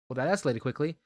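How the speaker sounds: usual speaking voice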